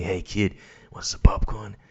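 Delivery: put-on hushed creepy whisper to a kid. Man in cinema whispering creepily to a kid. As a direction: lean over and whisper to the kid beside you. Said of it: "saying to the kids next to me".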